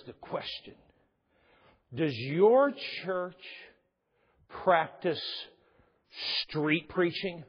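very slow, drawn out and ominous; dramatic slow question. Retold or imitated. Pastor mimicking the stranger's slow ominous question. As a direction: stretch every word like a spooky stranger.

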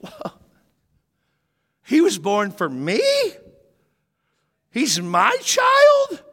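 hushed whoa, then a high, loud, slow cry of wonder; gasp, then marvel out loud; amazed shepherds. Retold or imitated. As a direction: awestruck shepherd realizing the baby is his.

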